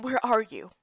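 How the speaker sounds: plain reading voice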